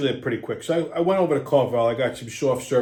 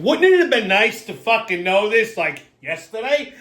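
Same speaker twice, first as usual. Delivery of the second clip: raised, higher-pitched complaint; retelling an indignant demand to know; exasperated retort; 8 semitones higher, 5 dB louder than usual. Retold or imitated. Patient complains to the insurance girl about late news, exasperated. Acted complaint to clinic staff.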